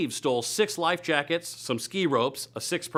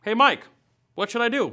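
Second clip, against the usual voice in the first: calling out; 9 semitones higher than usual. Real, not acted. Host calls out to Mike.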